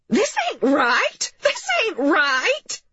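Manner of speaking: high, drawn-out wail of protest; distraught mother. A narrator's reading. Upset mother protests at police entering.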